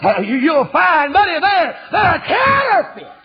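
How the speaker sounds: slow, loud, high preaching shout; full-force sermon shout; fiery preaching. Acted. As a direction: hammer the point home.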